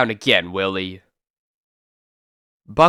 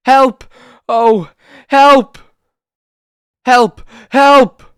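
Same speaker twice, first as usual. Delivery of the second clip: shrill, much higher, much louder cries for help; terrified scream; 12 semitones higher, 12 dB louder, much slower than usual. Acted. Nurse screaming for help in the night, terrified. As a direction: scream for your life down the hall.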